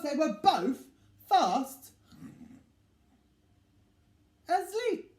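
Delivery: high, loud storybook finale; big finish. A narrator's reading. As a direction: end the story with a flourish. Story ending flourish.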